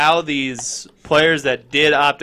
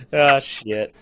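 normal talking voice

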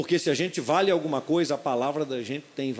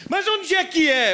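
put-on child's voice; 13 semitones higher, 6 dB louder, slower than usual. Voz do filho confuso.

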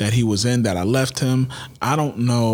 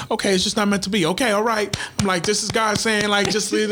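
mock relieved; 11 semitones higher, faster than usual. Mock relief, God says leave it.

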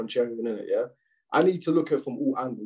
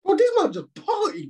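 incredulous outburst; 5 dB louder than usual. Real, not acted. Shocked at ministers partying.

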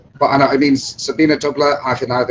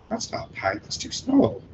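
mock indignant drinker; 2 semitones lower, 9 dB quieter than usual. German drinkers scoffing at the small pint, mock indignant. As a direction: act the Germans scoffing at a tiny glass. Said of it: "And they're going".

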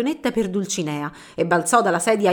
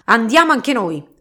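usual speaking voice

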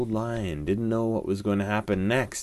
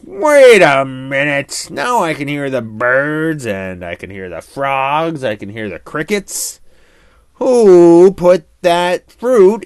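put-on louder, surprised old lion; a lion suddenly hearing again, then suspicious; 5 semitones higher, 11 dB louder than usual. A narrator's reading. Lion asks who put fruit in his ears, loud and surprised. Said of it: "And the old lion said".

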